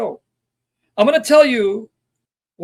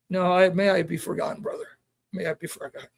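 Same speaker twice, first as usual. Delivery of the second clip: very soft, lower, pleading to be forgotten; humble near whisper; 3 semitones lower, 10 dB quieter than usual. Retold or imitated. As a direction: let it fade almost to nothing. Quoting Karamian asking to be forgotten.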